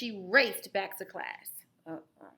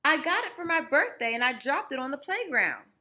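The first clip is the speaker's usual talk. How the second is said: put-on upset boy; 7 semitones higher, 9 dB louder than usual. Martin explaining to teacher.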